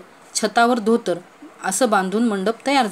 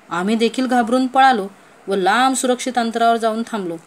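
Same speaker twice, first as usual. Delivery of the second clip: usual speaking voice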